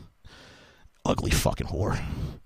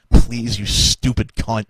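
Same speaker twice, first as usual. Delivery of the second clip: normal talking voice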